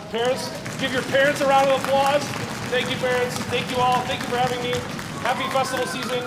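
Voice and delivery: high, loud sign-off over applause; calling out thanks. Real, not acted. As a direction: project your thanks to the whole hall.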